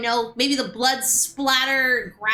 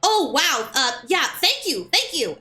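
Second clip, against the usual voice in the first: higher, mock-startled gratitude; imitated shocked couple; 5 semitones higher than usual. Couple warned about a graphic movie scene, mock shocked. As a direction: play taken aback, then thankful. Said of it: "the couple was so shocked".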